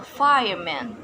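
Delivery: fireman with FIRE stressed; fireman, weight on the first part; stress on first term. Shown as a demonstration. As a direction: hit FIRE in fireman, then drop. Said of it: "stress placement is in the first term".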